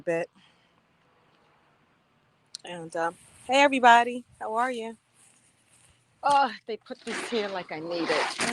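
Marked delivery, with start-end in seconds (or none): none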